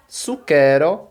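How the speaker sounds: "Suquero" said slowly, each syllable clear; teacher modelling "Suquero" slowly; slow careful Esperanto. Shown as a demonstration. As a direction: stretch "Suquero" out for the class. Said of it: "Eu vou só pronunciar cada uma".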